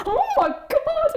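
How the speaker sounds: squeaky, slow, laughing oh my God; high laughing gasp. Retold or imitated. To the immigration officer, slow high squeal of mock shock. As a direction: act out being recognised in mock shock, laughing.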